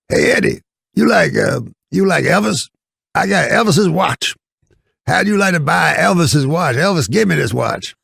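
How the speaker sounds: high, raspy hustler voice; Red Fox impression. Mimicking Red Fox pitching Eddie a watch, hustling and high. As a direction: pitch it way up like a fast-talking hustler. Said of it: "he's super funny".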